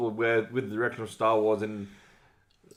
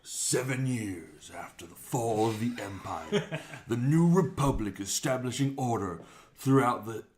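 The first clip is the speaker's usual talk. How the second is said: radio voice; slower than usual. Pretend. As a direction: read it like a movie trailer. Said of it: "Now do it like a radio voice".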